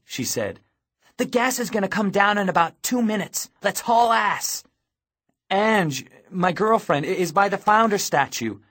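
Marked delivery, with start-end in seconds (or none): 1.2-4.6 high, raised, pressing voice; urgent warning. A narrator's reading. Girl warns boy that gas is coming, urgent and high. 5.5-8.6 raised, high, insistent; urgent protest. A narrator's reading. Boy tells captor where his girlfriend is, urgently. Pleading for girlfriend left behind.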